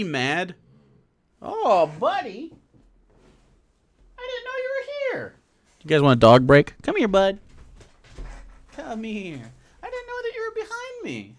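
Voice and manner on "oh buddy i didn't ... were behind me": very high, sing-song voice cooing at the dog. Real, not acted. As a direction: coo like you're greeting a puppy. Greeting his dog.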